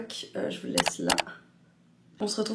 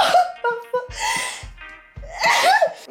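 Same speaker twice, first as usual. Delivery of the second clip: high laughing fit; 6 dB louder than usual. Real, not acted. Laughs after choking on drink.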